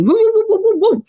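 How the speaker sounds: high dog yelps. Retold or imitated. Imitating small barking dogs.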